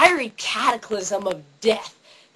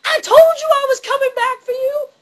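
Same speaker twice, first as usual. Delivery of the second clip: high, loud hammed-up yell; vengeful trolley driver; 8 dB louder than usual. Driver catching Connery at film's end, hammed-up vengeful shout. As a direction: holler a triumphant comic threat. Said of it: "trolley driver had come up and been like".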